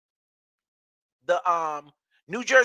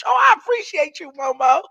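excited thanks; 8 semitones higher, 7 dB louder than usual. Real, not acted. Thanking fan Momo, high.

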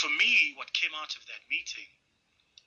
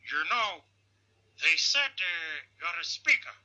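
slow, stately elder impersonation, pitched up; Nelson Mandela; 3 semitones higher, slower than usual. Acted. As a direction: stretch the words out with deliberate weight. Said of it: "he looks at me and he says".

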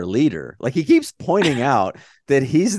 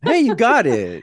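patronizing; 10 semitones higher, 5 dB louder than usual. Acted. Mocking patronizing praise.

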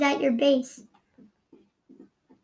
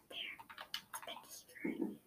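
whispering while hiding; 25 dB quieter than usual. Real, not acted. Hiding from enemy in game.

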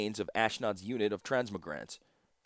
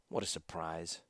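much softer and lower, dry sarcastic mutter; 4 semitones lower, 9 dB quieter than usual. A narrator's reading. Ashnod scorning Jarin's report, in a low sarcastic mutter. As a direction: mutter it under your breath with scorn. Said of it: "muttered Ashnod".

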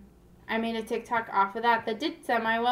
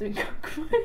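amused, laughing while talking; 5 semitones higher than usual. Real, not acted. Joking about grandma's Coke money, laughing. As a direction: laugh breaking into the words.

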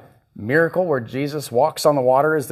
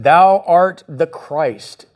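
slow, louder, every word held; slow solemn confession; 3 semitones higher, 6 dB louder, much slower than usual. Shown as a demonstration. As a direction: proclaim it slowly like a creed. Peter's confession, read with weight.

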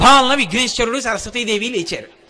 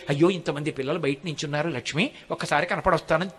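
gentle Lord's voice; 5 semitones lower, 5 dB quieter than usual. Acted. Venkateswara telling Lakshmi the devotees are waiting, soft aside. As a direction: lower and softer, a light tender aside.

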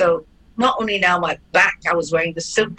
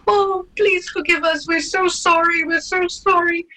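high, sing-song apology of two ladies; apologetic ladies; 12 semitones higher than usual. Retold or imitated. The two ladies apologising to the robbed girl, high and gushing. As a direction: gush out the sorry in a lifted lady voice. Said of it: "they both laughed and they said".

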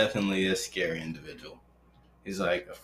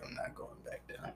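whispered; 18 dB quieter than usual. Retold or imitated. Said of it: "I'm like".

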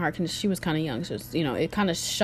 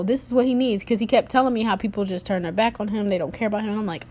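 ordinary delivery

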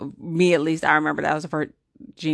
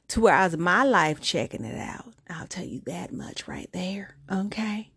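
whispered aside. Real, not acted. Confiding aside to viewers.

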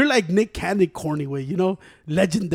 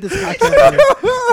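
higher, laughing, slow; 8 dB louder, much slower than usual. Real, not acted. Joking about ending the podcast, laughing. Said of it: "You're mad corny".

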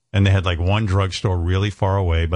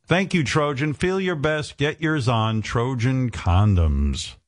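ad read; 4 semitones higher, slower than usual. Acted. Mock ad read after joke.

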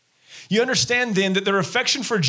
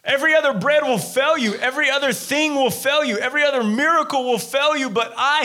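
higher, building urgency, each line hammered; impassioned plea; 7 semitones higher than usual. Retold or imitated. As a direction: plead it, rising with each repeat. Preacher voicing Jesus' plea.

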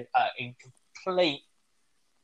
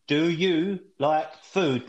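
slow, loud, overly clear question; talking to a foreigner; 3 semitones higher, 3 dB louder, much slower than usual. Retold or imitated. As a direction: spell it out slowly for a foreigner. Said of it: "you speak slowly and loudly".

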